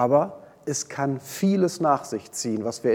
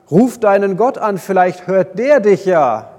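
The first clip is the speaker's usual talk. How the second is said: louder, higher, pressing call to pray; urgent plea; 4 semitones higher, 6 dB louder than usual. Acted. Sailors rousing Jona in the storm, loud urgent demand. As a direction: wake him and demand he pray.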